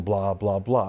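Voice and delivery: low, droning blahs; dismissive skimming. Retold or imitated. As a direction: tail off the letter as not worth reading. Skimming a form letter dismissively.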